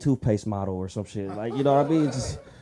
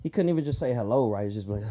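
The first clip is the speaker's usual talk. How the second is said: usual speaking voice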